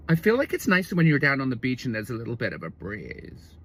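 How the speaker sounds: mock her sentence sinking into croak; croaky Californian girlfriend. Comedian quoting her on the beach breeze, trailing croak. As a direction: start normal, run on into a creaky tail. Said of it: "run on and speak like this".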